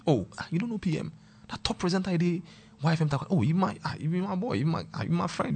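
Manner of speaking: mock boastful tone; playing a bragging old acquaintance. Old acquaintance bragging about knowing P M, mock boastful. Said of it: "now bragging that they know".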